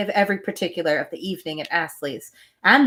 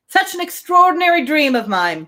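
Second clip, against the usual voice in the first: cried out; 7 semitones higher than usual. A narrator's reading. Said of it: "he cried".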